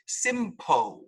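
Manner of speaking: simpo with a vowel for the final L; wrong simpo. Shown as a demonstration. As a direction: say simpo, dropping the L sound. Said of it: "this word is not pronounced simpo".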